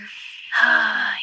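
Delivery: a loud, tired sigh of agreement; heavy sigh. Real, not acted. AI companion agreeing with her user, heavy resigned sigh. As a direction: let the breath out heavily, resigned. Agreeing she cannot scream.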